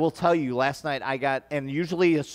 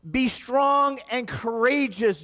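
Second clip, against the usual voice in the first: preaching; 8 semitones higher, slower than usual. Acted. Preached quote of the Lord.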